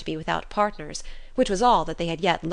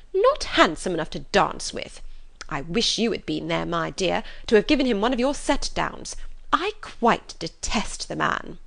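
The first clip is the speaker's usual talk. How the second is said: bitter indignation; 2 semitones higher, 4 dB louder than usual. Acted. Mother complaining of Darcy to husband, indignant. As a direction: huff with exaggerated outrage. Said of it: "with much bitterness of spirit and some exaggeration".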